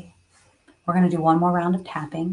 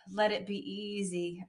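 much softer, let go of the words; a soft, released echo of the first take; soft repeat; 9 dB quieter than usual. Real, not acted. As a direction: let it sink in quietly. Tapping affirmation said twice.

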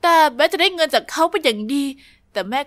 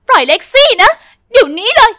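louder, higher yell to let her sister go; furious shout; 7 semitones higher, 10 dB louder than usual. Acted. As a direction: bellow it at the stranger. Girl yells to free sister. (ตะโกนสั่งเสียงดังลั่น)

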